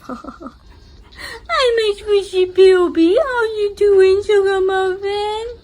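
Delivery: high, loud, slow cooing to a pet. Real, not acted. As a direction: coo at a beloved pet. Talking to her pet.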